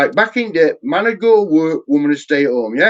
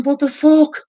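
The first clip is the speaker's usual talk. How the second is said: mock disbelief; 10 semitones higher, slower than usual. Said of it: "they're going".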